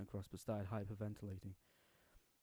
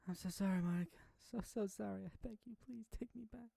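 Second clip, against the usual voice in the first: sobbing harder; 11 semitones higher than usual. Acted. Sayori begging sleeping Monika to take her back, sobbing. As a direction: beg through heavy sobs. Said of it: "She sobbed harder".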